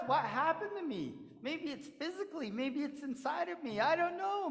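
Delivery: a lightly voiced puzzled coyote. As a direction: muse it over, baffled. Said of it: "And he went".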